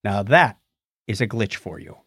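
normal talking voice

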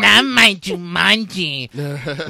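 high, slow whiny troll voice. Pretend. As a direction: whine it like an angry fanboy. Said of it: "can I see the troll voice?".

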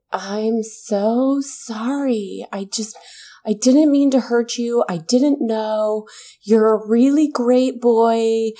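imitating a teary old aunt; mock tearful apology. Retelling the half-sister's teary apology to Kendall in mock tears. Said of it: "starts to cry".

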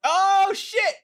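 high, loud celebratory shout; triumphant cheer. Real, not acted. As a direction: whoop at winning the round. Celebrates a correct guess.

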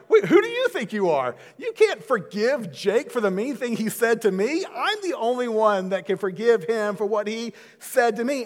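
indignant wife. Pretend. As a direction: play the wife who objects to being bypassed. Wife Krista voiced, indignant.

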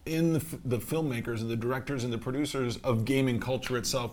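normal talking voice

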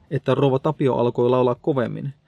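ordinary delivery, nothing marked

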